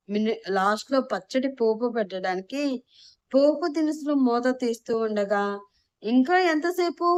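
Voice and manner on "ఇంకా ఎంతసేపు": mild narrated yell, pitched high; impatient yell. A narrator's reading. Husband bursting into the kitchen asking about food, mild impatient yell. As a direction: call out impatiently, raised pitch but not full volume. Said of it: "అరుస్తూ గదిలోకి వచ్చాడు".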